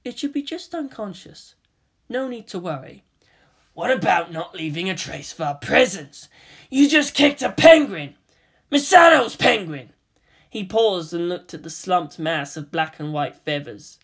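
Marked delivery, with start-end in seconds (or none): 0.0-3.0 calm. Acted. Kaoru dismissive after kicking penguin. 3.7-6.3 angry. Acted. Kaji outraged at Kaoru. 6.7-8.2 angry. Acted. Kaji yells in disbelief. 8.7-9.9 angry. Acted. Kaji outraged at Kaoru.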